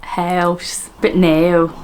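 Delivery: attempted Belfast vowels; Northern Irish accent. Vlogger practising Belfast words, Northern Irish. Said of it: "my attempt at Northern Irish".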